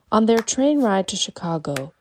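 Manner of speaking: usual speaking voice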